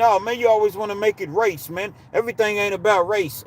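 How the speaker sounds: lightly mock a tired objection; mimicked complaint. Mimicking people who object to race talk, mock complaint.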